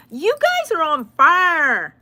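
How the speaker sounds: slow, high, loud hyped-up praise; drawn-out gleeful shout; excited praise. Real, not acted. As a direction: stretch it out like a cheer. Praising viewers for likes.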